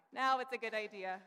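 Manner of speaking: a loud, bright put-on "now it's a good idea"; loud mock enthusiasm; mock enthusiastic agreement. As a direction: ham up the sudden approval.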